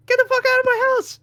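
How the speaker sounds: very high-pitched mock yell; acted angry outburst. Boyfriend to girlfriend, acted furious eviction. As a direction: imitate blowing up at someone.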